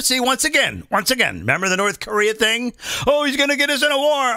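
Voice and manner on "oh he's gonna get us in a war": fast, higher whiny alarm; mock panic. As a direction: parody the worriers. Mocking critics' panic.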